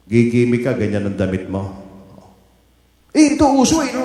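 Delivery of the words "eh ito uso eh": put-on high, loud whiny kid; a teen whining to a parent about clothes; whiny teen reply. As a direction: answer back like a sulky teenager. Child answering parent, voiced.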